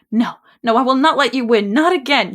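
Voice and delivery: defiant cry. Acted. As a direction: refuse to give in. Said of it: "bad impression voiceover".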